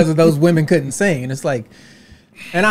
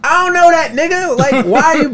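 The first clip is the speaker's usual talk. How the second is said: much higher, loud indignant cry; exasperated protest; 12 semitones higher, 6 dB louder than usual. Retold or imitated. Comedian reenacting his outraged reply to the booing crowd. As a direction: plead with the crowd in disbelief.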